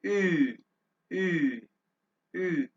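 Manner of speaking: ऊ said as German Ü, three times; teacher repeating the Ü sound; umlaut Ü. Shown as a demonstration. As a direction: model the Ü vowel. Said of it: "और इसको".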